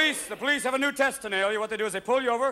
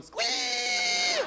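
very high squealed cry; Deliverance squeal. Retold or imitated. Jack Daniels drinker turns Deliverance hillbilly, very high squeal. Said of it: "road company in Deliverance going".

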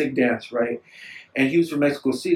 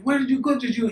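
imitated native accent, pitched well up; native Mexican accent; 8 semitones higher than usual. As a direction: put on his native accent in a high voice. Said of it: "you know, in his accent".

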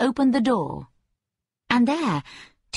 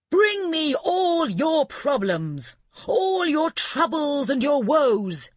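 scream; 6 semitones higher, slower than usual. A narrator's reading. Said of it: "he screamed".

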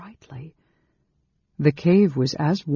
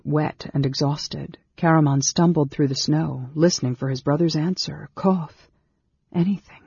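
ordinary delivery

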